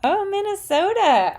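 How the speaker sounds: light up at the name, loud and high; excited. Real, not acted. Streamer spots a viewer from Minnesota, excited high cry.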